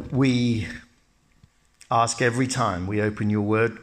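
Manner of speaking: ordinary talk, nothing marked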